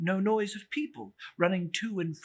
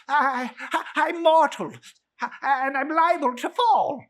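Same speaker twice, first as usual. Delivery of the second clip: high, loud frightened plea; pleading old Scrooge; 10 semitones higher, 11 dB louder than usual. Acted. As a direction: Scrooge begs not to fall.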